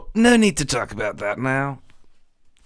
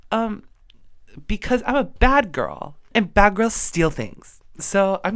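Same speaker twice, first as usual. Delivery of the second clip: put-on high bubbly valley girl voice; sassy teen mermaid, high pitched; 6 semitones higher than usual. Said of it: "different voice for the mermaid".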